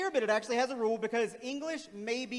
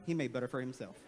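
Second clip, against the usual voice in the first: normal talking voice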